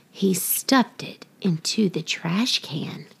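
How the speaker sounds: ordinary delivery, nothing marked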